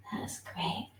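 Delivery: soft whispered praise. Real, not acted. Teacher whispering encouragement to her class. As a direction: breathe it as a whisper.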